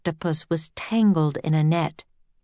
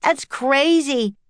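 slow, higher indignant yell; 6 semitones higher, slower than usual. A narrator's reading. Jack disputing the captain's warning, yelling. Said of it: "yelled Jack".

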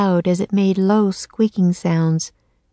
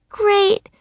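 high-pitched cheer; delighted. A narrator's reading. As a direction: cheer the bird's success. Annie claps as the raven flies.

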